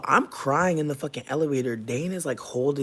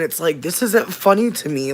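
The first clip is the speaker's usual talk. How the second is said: louder, higher retold objection; indignant, raised-voice retort; upset protest; 4 semitones higher, 9 dB louder than usual. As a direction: push back hard at people laughing. Recounts protesting at the nervous laughter.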